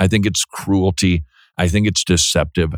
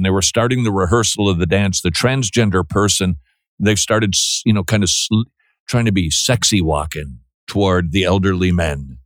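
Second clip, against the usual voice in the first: ordinary delivery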